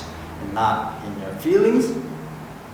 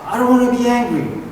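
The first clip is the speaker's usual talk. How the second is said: high, fast whine about being angry; whiny self-talk; 7 semitones higher, faster than usual. Retold or imitated. Preacher imitating a listener's whiny self-talk. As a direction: complain like someone fed up with their own anger. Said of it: "Have you ever done that?".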